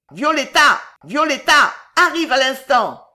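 loud, high-pitched yell; howled order. A narrator's reading. Belgodère summoning Violetta as he climbs the steps, howling. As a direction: holler an impatient summons. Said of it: "hurlait Belgodère".

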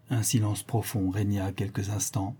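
plain reading voice

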